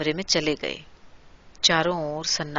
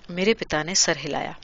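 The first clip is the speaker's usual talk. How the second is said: plain reading voice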